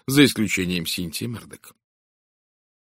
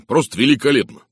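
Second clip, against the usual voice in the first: loud, higher-pitched admiration; exclaiming; 5 semitones higher, 7 dB louder than usual. A narrator's reading. Inspector congratulates Poirot on his evidence, exclaiming. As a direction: praise it with loud enthusiasm. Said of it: "воскликнул он".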